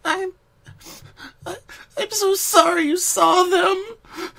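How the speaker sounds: high, tearful crying voice; sniffing through sobs. Acted. Pinky sobbing to Twilight after the nightmare. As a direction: choke her name out through tears. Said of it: "Pinky sniffed through her sobs".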